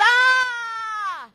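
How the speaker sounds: high loud shriek of fright; startled yell. Acted. Father jumps when Lance says boo, startled high shriek. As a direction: scream like you got jumped.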